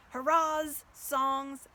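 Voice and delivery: usual speaking voice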